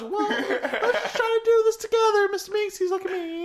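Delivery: high, eager cartoon helper voice, laughing through it; Mister Meeksy voice. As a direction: squeak it up like a chipper little helper who exists to serve. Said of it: "He's like".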